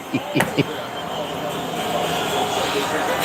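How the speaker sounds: breathless, silent wheeze of a laugh; doubled over, laughing without sound; silent wheezing laugh. Real, not acted. Cracking up at his own sausage joke, silent wheeze laugh.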